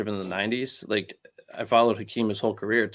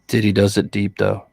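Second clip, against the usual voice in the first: creepy voice; 2 semitones lower, 7 dB louder than usual. Pretend. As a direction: make it sound creepy. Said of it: "That was really creepy".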